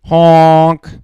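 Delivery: loud honk; goose honk. Acted. Dad voicing the goose asking for a tree, loud honk. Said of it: "says the goose".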